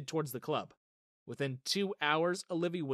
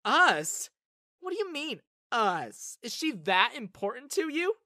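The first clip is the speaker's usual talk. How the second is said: shocked; 8 semitones higher, 6 dB louder, slower than usual. Acted. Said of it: "glanced at me in shock".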